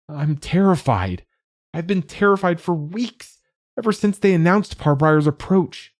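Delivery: high, loud, frightened sobbing; tearful and scared. A narrator's reading. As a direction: blurt out fear through tears. Terrified child sobbing to father.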